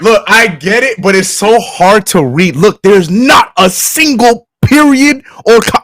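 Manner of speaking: high, drawn-out griping; slow, high-pitched, emphatic protest; exasperated complaint. Real, not acted. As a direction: stretch the words out in comic frustration. Complaining that the post is unreadable.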